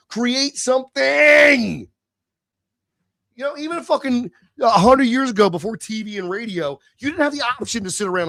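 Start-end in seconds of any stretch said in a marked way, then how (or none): 0.1-1.9 slow, higher and louder, each word stretched; slow emphatic shout. Real, not acted. Urging his viewers to stop gaming, slow shout. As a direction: lean on it like a command.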